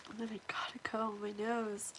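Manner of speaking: fast breathy whisper. Real, not acted. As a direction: whisper so no one hears. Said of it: "I have to make it quiet".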